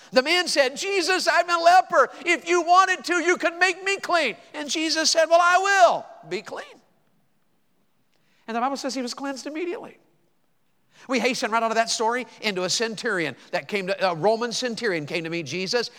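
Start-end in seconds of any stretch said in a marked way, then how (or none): 0.1-10.0 high, loud preacher's telling; preaching at full force. Acted. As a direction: shout the miracle to the room. Preaches the leper story loudly.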